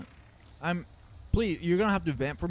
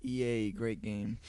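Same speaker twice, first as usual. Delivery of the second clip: sarcastic; 3 semitones lower, 7 dB quieter than usual. Acted. Sarcastic praise of EA.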